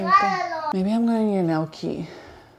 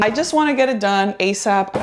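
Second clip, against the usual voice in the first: normal talking voice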